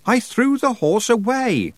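high, drawn-out shout; calling out. A narrator's reading. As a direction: holler it across a great distance. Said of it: "cupped her hands to her mouth and shouted".

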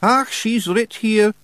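high, loud broad Scottish wee man; a cocky little pixie laying down the law; Rob Anybody Feegle. A narrator's reading. Rob reads.

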